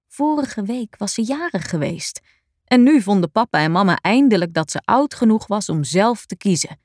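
plain reading voice

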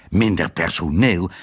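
"Minder personeel?" repeated low and falling in disbelief; incredulous echo. A narrator's reading. Husband to wife, a low disbelieving echo. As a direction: throw her words back at her.

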